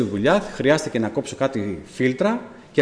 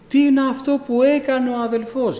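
a higher, aggrieved church brother griping; indignant brother; 12 semitones higher than usual. Retold or imitated. A brother complaining to him about the pastor, indignant. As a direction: protest like a wounded fellow believer.

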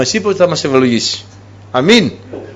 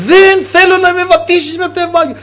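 the same refusal shouted once more; retold shouting; 13 semitones higher than usual. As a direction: cry it out in stubborn refusal. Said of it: "άρχισε να λέει".

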